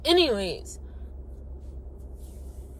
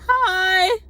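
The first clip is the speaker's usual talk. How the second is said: high, loud, drawn-out hi; stretched, squealed greeting; young woman's greeting; 9 semitones higher, 8 dB louder than usual. Retold or imitated. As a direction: imitate her high-pitched hello. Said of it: "She's like".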